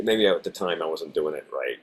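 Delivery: ordinary delivery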